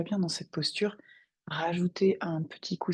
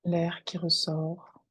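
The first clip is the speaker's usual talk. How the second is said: guided meditation. Acted. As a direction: guide them gently into their breath. Guide une méditation. (voix de méditation guidée, calme et posée)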